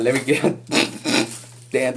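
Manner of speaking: ordinary talk, nothing marked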